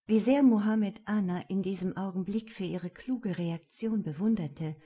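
plain reading voice

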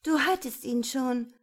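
high, loud objection; protesting. A narrator's reading. Woman objects to the man's order, high and protesting. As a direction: push back in alarmed protest.

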